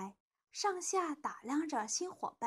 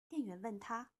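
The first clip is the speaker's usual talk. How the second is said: plain reading voice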